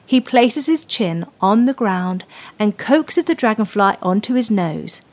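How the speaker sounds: ordinary delivery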